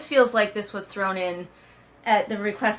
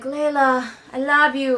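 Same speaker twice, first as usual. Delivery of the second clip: high, loud hammed-up declaration; mock dying confession; 5 semitones higher, 5 dB louder than usual. Dying Tobias to Layla, hammed-up loud love cry. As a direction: overplay the last-breath love line. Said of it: "Tobias is like".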